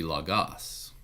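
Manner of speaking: logos as log-OS, final syllable stressed; stress on second syllable. Shown as a demonstration. Teacher reading a moved accent mark, logos stressed on GOS. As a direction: land on the last syllable of logos. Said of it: "in the case of something like this".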